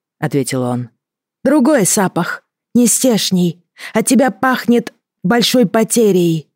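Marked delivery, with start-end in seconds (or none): 1.4-6.5 creature voice. Acted. Creature Henrich speaking to Katya.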